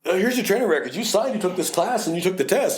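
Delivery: spring the trap; mock gotcha lawyer. Lawyer springing records on the officer, high and triumphant.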